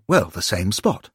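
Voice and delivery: ordinary delivery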